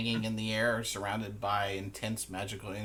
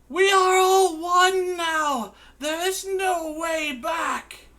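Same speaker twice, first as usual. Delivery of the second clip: very high, loud, slow wailing cry; Carrie crying out; 12 dB louder, slower than usual. Acted. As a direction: sob and shout it in despair. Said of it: "Carrie ... says".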